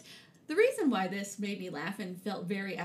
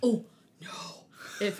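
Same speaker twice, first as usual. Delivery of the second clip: whispered mock terror; mock-horror whisper. Host whispering a silly spooky pun. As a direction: hush it like a spooky secret.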